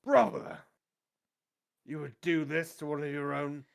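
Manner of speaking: high, loud cry, then pleading reproach; appalled captain. Acted. Captain looks up at the archer, anguished appeal. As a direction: appeal to a brother who betrays you. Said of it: "He looks up at you before you do so".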